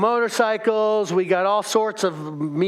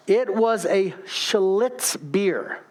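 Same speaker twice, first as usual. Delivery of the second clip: measured, over-careful enunciation so nothing is misheard; slow, careful pronunciation; much slower than usual. Real, not acted. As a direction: say it slowly, word by word. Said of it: "have to say it slowly as to pronounce it".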